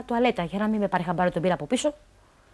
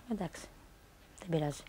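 much softer, lower, letting it go; resigned quiet giving in; 6 semitones lower, 14 dB quieter than usual. Retold or imitated. Wife quietly gives in to her husband's excuse, resigned. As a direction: sigh it out as if giving up.

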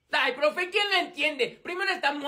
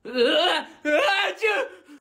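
startled yelp; 4 semitones higher, 5 dB louder, much slower than usual. Acted.

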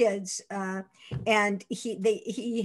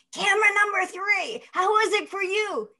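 much higher call to the catwalk; calling far up; 11 semitones higher than usual. Retold or imitated. DeMille calls far up to camera three, higher. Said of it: "So he said".

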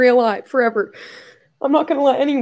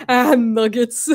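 slow, laughing through the words; laughing "Ah, nuggets"; much slower than usual. Retold or imitated. Repeating her late friend's catchphrase, laughing slowly. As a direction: let the laugh spill into it.

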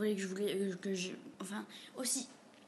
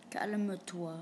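ordinary talk

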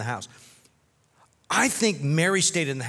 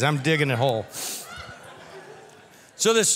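ordinary talk

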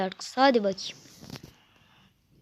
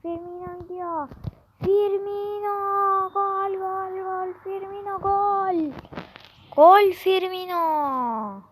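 high drawn-out goal calls; football commentator; 7 semitones higher, slower than usual. Pretend. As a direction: stretch the goals like a TV commentator. Commentating own match goal.

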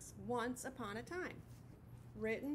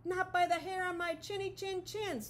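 high, defiant pig voices; pigs replying; 7 semitones higher, 3 dB louder than usual. Acted. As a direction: answer back boldly. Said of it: "the pigs replied".

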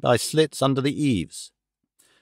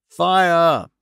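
acted cry of alarm, raised pitch; yell; 4 semitones higher, 4 dB louder than usual. Gentlemen raising a false fire alarm, yelling. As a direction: call it out as a restrained yell. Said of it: "we all yelled".

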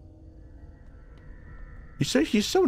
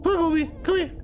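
high sing-song pet call; coaxing a puppy; 14 semitones higher, faster than usual. Real, not acted. Player calling the game puppy over, high coaxing pet voice.

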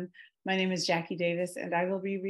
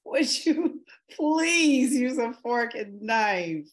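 slow, louder, deliberate scolding; pointed request; 5 semitones higher, 5 dB louder, much slower than usual. Acted. Wife slowly chiding husband at breakfast, pointed. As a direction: spell it out slowly to make a point.

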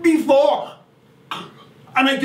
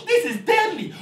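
much higher, excited shout; thrilled; 10 semitones higher than usual. Acted. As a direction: crow with delight. Thrilled by the fish.

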